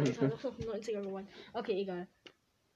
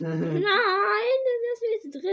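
slow, loud, higher fake wail; mock whining; 9 semitones higher, 9 dB louder, much slower than usual. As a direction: whine like a kid begging. Pretend whining to keep clip. (gespieltes Jammern)

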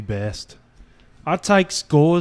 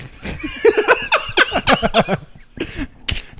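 high cackle. Real, not acted. Laughing mid-bit.

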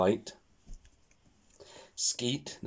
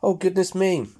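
worried; 9 semitones higher, 6 dB louder than usual. Acted. Mummy Pig worried.

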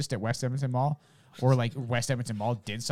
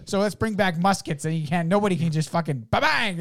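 loud, raised comic rant; hammed-up bit; 7 semitones higher, 6 dB louder than usual. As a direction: build to a mock gunshot. Animated impression of comic's punchline.

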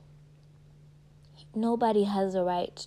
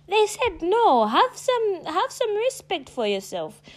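much higher, slow exasperated cry; exasperated disbelief; 9 semitones higher, slower than usual. Real, not acted. Scolding people who push past a no, exasperated. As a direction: throw up your hands at the nerve of them.

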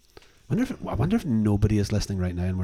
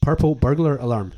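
tongue twister again; 4 semitones higher, 7 dB louder than usual. Shown as a demonstration. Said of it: "Do it again".

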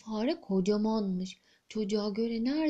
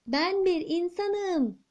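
higher, slow shout with bir stressed; boy shouting so the giant queen hears, a touch higher; 6 semitones higher, slower than usual. Acted. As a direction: shout it up to a giant who can't hear. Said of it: "diye bağırmış bu sefer".

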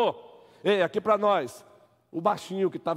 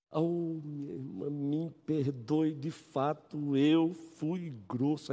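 slow humble apology; 3 semitones lower, 7 dB quieter, much slower than usual. Acted.

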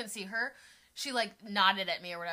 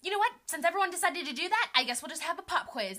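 high, loud opening that eases off; exasperated teacher; 7 semitones higher, 3 dB louder than usual. Retold or imitated. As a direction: announce a punishment like a fed-up teacher. Acting teacher announcing pop quiz.

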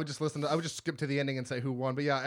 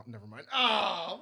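ordinary talk

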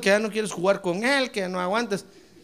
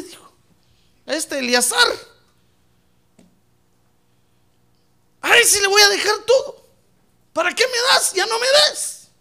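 put-on old man pleading, higher and louder toward the end; weary complaining Abraham; 7 semitones higher, 4 dB louder than usual. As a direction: whine to God that you're too old.

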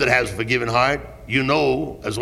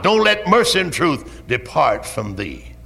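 normal talking voice

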